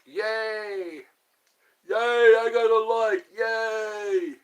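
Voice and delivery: high, loud puppet-character cheer; hammed-up, childlike celebration; Special Ed. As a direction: draw out each yay in a goofy voice. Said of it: "I like Special Ed".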